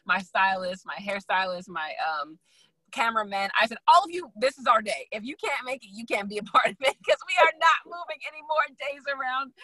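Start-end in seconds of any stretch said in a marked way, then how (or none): 3.9-9.5 raised-pitch, emphatic no-excuses order; insistent ultimatum. Acted. Designer telling her crew the date stands, insistent. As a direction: lay down the law to the crew, pitched up high.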